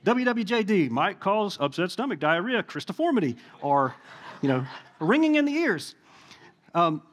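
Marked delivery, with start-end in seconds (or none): 0.0-3.4 lightly voiced TV side-effect disclaimer; a hint of commercial voiceover; drug commercial. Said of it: "some sort of side effect from a T V, you know, drug commercial". 5.0-5.9 higher-pitched side-effect read, lightly put on; mock TV disclaimer; drug commercial. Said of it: "side effect from a T V, you know, drug commercial".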